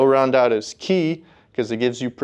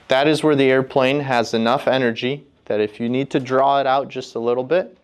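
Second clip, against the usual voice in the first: ordinary talk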